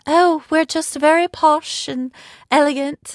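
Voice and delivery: mock posh British. Mocking how Americans hear the British, hammed-up posh British accent. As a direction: play the snooty elegant Brit in a posh British accent. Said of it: "it's that higher pitch as well as the accent".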